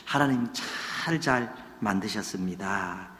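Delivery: very soft, low, slow Seoul standard; hushed, elegant Seoul accent; smooth Seoul speech. Shown as a demonstration. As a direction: glide gently through refined Seoul speech. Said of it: "서울 말이 좀 매끄럽고 좀 고급스럽고".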